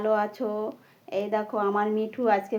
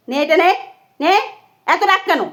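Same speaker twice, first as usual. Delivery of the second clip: loud coaxing to angry bird; 4 semitones higher, 6 dB louder than usual. Real, not acted. Pushing food at angry parrot.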